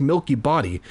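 ordinary delivery

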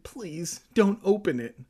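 acted anxious begging; pleading. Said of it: "Evan pleaded".

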